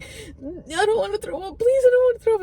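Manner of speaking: high, begging whimper rising to a wail; high, teary pleading; pleading whine. Retold or imitated. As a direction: beg like a sick child. Begging not to throw up.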